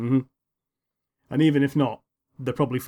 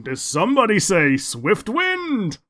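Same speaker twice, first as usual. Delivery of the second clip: heroic announcement; 7 semitones higher, 4 dB louder, slower than usual. Retold or imitated. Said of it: "his normal entrance is".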